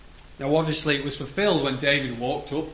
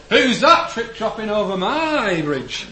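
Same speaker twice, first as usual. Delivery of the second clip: slow, louder and higher troll challenge; 7 semitones higher, 9 dB louder, slower than usual. Retold or imitated. Preacher voicing grandma's troll, slow and loud. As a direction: boom it out like a storybook troll. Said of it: "That's what Grandma used to say it like".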